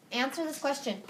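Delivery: ordinary talk, nothing marked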